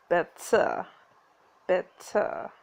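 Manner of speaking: "better" with a crisp T and no final R; slow, low "better", T hit hard, ending in "uh"; hard-T British "better". Shown as a demonstration. Player practising the coach's rule, hard-T British "better". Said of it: "posh Brits enunciate the T".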